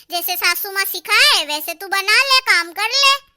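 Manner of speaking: ordinary delivery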